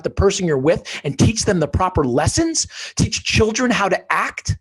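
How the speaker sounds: passionate. Real, not acted. Telling the host what his daughter taught him, fervent. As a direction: drive the lesson home with feeling.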